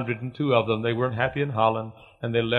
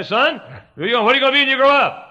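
high, raised voice quizzing a son; loud fatherly questioning; 14 semitones higher, 6 dB louder, faster than usual. Retold or imitated. Father asking his small boy about growing up, loud and high. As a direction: boom the question out, much higher than usual.

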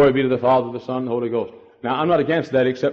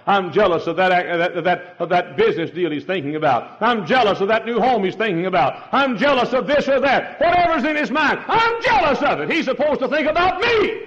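climbing higher and louder with each line; building jealous cry; 10 semitones higher, 3 dB louder than usual. Acted. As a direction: build the list to a peak. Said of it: "And Jesus said".

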